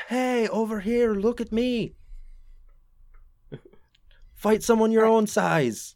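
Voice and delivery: high, drawn-out yelled taunt. Acted. Archer taunting a giant lizard to come at her, high taunting yell. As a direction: yell it to draw the monster's attention. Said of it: "yelling, like".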